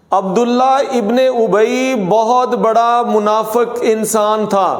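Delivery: ordinary talk, nothing marked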